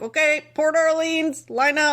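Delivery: high, drawn-out driver's call-out; imitated shout. Podcaster imitating a bus driver calling resort guests, mock shout. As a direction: call it out slowly to a waiting crowd, lightly imitated. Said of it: "and he just shouted".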